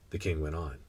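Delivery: ordinary delivery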